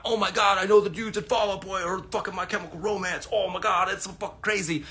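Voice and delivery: mock the starstruck fan bragging about famous dudes; mock gushing fan. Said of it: "My life is not".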